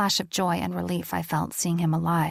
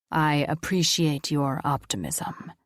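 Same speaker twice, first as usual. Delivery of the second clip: solemn deep voice of an angel; 3 semitones lower than usual. Acted. Angel answers Riker dryly.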